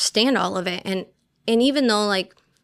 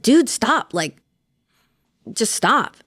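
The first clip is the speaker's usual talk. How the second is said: loud, higher, fed-up "stop"; raised voice warning a heckler; angry warning; 4 semitones higher, 4 dB louder, slower than usual. Retold or imitated. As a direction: snap at him to knock it off. Telling the mocking guy off.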